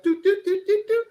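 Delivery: imitated high phone-dial beeps; short even high toots; touch-tone beeps. Mother dialing father on touch tone, imitated high beeps. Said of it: "if it was touch tone".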